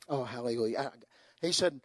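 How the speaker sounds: ordinary delivery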